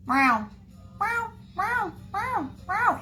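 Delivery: high, insistent cat meows; pestering cat impression; imitated meowing. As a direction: meow over and over, small and high. Imitates cat waking her up.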